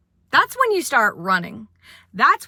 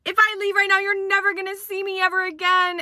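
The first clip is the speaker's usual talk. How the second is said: high, dramatic threat; hammed-up ultimatum; 9 semitones higher, faster than usual. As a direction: storm out with an ultimatum. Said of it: "she's like".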